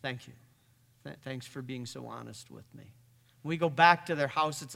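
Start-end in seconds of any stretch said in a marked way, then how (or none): 0.0-2.9 soft and low, simply grateful; a hushed, steady thank you; quiet, calm thanks. Retold or imitated. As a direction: thank her softly and plainly. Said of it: "And he just says".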